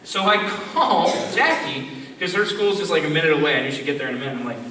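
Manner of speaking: ordinary delivery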